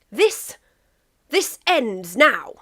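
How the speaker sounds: slow, firm and deliberate; England, drunk but certain, declaring to Russia; very sure of himself. A narrator's reading. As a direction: lay down the law, drawing each word out. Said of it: "very sure of himself".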